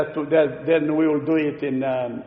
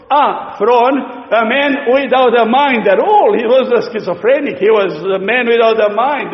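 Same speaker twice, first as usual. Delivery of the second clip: raised, louder preaching; forceful preaching; 5 semitones higher, 5 dB louder than usual. Acted. Preacher warning the congregation, forceful preaching. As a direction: drive the warning home from the pulpit.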